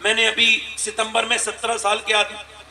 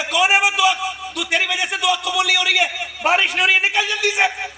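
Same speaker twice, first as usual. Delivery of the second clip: urgent call out; 9 semitones higher than usual. Acted. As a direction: demand the sinner step forward. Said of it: "موسیٰ نے کہا".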